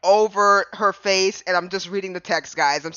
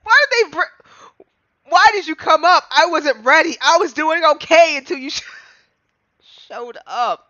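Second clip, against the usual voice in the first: higher, louder panicked protest; flustered outburst; 9 semitones higher, 7 dB louder than usual. Real, not acted. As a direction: blurt it out, caught off guard. Flustered by the character appearing.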